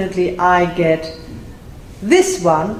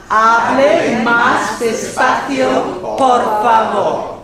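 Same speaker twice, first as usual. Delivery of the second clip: very slow, stretched teacher's model; laborious; 3 dB louder, much slower than usual. Shown as a demonstration. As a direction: labour over each word for learners to repeat. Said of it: "you have to say laboriously".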